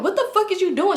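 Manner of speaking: high, startled male shout; yelling in disbelief at her; imitating a guy freaking out. Man yelling during a chaotic scene.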